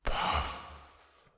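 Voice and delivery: soft breathy snort of disdain. Acted. Wind snorting at losing to Sun. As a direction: blow it out like a sulky snort. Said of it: "snorted Wind".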